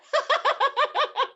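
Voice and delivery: high burst of genuine laughter; real laugh. Shown as a demonstration. Showing captioning a real laugh, high and bursting. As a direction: laugh for real, not the words. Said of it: "But if I laugh".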